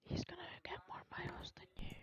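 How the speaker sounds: hushed whisper, no voice. Real, not acted. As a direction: breathe it like a secret. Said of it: "Stop whispering".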